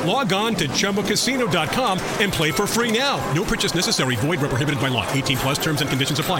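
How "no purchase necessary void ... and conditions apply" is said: quick low fine-print read; fast disclaimer. Acted. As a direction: rattle off the legal disclaimer.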